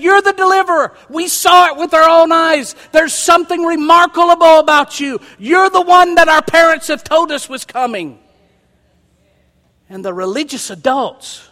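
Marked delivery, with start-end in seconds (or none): 0.0-8.2 put-on high, loud, eager shout of children; excited children. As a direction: shout it out like thrilled kids. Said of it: "They said".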